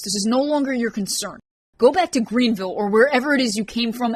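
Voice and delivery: high, loud, scolding man; a man telling her off at full volume; Ryan angry. Acted. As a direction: bite every line at her, furious. Ryan's furious scolding of Rain.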